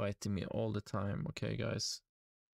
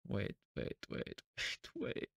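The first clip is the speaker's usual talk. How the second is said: a soft whispered wait, wait, wait, much softer; hushed wait; 2 semitones lower, 6 dB quieter, slower than usual. Real, not acted. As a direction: hold your breath and whisper it. Nervous before buying.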